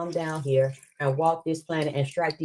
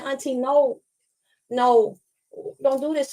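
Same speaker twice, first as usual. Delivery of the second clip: high, lightly voiced child begging; a hint of a small girl saying no; little girl pleading; 7 semitones higher, slower than usual. Girl begging her auntie to stop, high and pleading. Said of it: "she kept saying".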